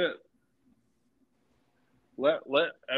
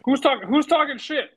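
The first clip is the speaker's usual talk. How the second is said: high loud call-out; 13 semitones higher, 7 dB louder than usual. Acted. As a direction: pitch jumps way up, loud and accusing. Calling out mock accusation.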